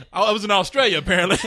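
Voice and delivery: normal talking voice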